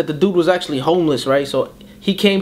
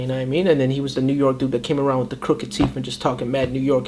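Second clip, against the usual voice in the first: usual speaking voice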